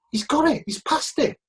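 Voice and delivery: high-pitched, thrilled announcement; excited news. Retold or imitated. Guy ringing up with the good news, excited. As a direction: break great news in a high, eager voice.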